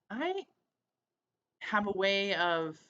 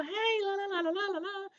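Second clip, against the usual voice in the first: high, bouncy la-la-la of a cheered-up woman; excited sing-song. Retold or imitated. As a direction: sing it out, happy and light.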